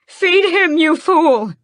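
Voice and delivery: high, loud screaming command. A narrator's reading. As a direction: scream it across the cavern. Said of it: "she screams".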